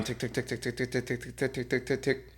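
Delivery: very soft, even tongue ticks; imitated coaster ticking. Rider mimicking the coaster's climb, hushed mechanical ticking. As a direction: tick softly like a climbing chain lift.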